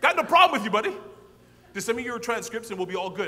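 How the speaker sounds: loud, high cheerful dean; loud high friendly dean voice; retold dean. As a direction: boom it out like a jovial dean. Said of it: "the dean says".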